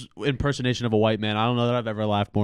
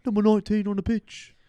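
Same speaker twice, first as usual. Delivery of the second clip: British announcer; 12 semitones higher, 6 dB louder than usual. Retold or imitated. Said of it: "British dude calling soccer games".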